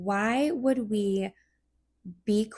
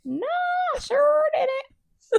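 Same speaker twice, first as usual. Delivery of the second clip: very high, slow quavering granny; old lady voice; slower than usual. Pretend. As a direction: stretch it out like a frail old woman. Answering in old-lady role.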